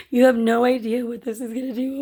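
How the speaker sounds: high-pitched, emotional gushing; overwhelmed. Retold or imitated. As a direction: re-enact being overcome with gratitude. Retelling overwhelmed reaction to win.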